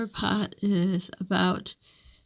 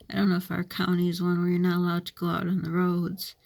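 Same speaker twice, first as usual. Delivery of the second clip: normal talking voice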